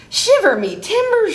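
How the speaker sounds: high, loud pirate oath; pirate shout. A narrator's reading. Pete hears unpiratical talk, high outraged shout. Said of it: "shouted Pete".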